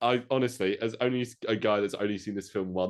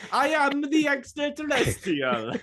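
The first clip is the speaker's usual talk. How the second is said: high, slow alien voice; 4 dB louder, slower than usual. Pretend. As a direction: play the alien, drawn out. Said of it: "E T noise".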